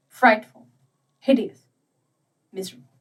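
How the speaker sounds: ordinary delivery, nothing marked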